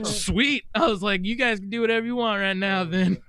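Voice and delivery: high, loud mock-euphoric outburst; retold giddy delight. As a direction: sing out carefree approval, high and loud. Happily agreeable on morphine, retold.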